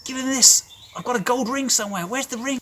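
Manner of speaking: high, loud, hurried joy; excited father calling out; urgent happy orders. Acted. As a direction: rush the servants, beaming with joy. Father calls for the ring.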